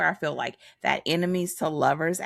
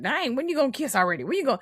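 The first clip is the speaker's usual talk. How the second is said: high, fast impatient whine; urging them to kiss already; 9 semitones higher, much faster than usual. Retold or imitated. Acted impatient reader quote.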